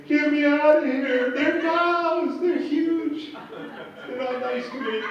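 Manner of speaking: high, loud bleating goat voice; hammed-up high-pitched goat pleading for rescue; panicked talking goat. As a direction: bleat the words out like a terrified goat. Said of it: "If he could talk".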